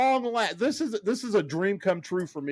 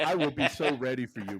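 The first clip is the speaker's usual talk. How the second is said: ordinary talk, nothing marked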